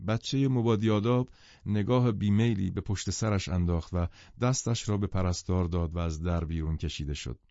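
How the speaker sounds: ordinary delivery, nothing marked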